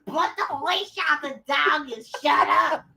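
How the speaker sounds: high, shouted scolding; mock mom shout. Daughter imitating her mother shouting at her to shut up. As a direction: bark the order like a fed-up mom. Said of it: "Calm it down".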